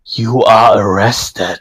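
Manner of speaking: slow, booming voice like his father; dark booming voice. Acted. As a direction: boom it out slowly, darkly. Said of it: "dark, booming voice that is very similar to his father".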